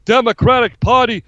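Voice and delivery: ranting candidate impression; Bernie Sanders. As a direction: rant like an aggrieved old politician, picking up pace. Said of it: "Bernie shoulda went out there and be like".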